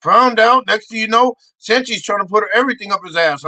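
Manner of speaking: normal talking voice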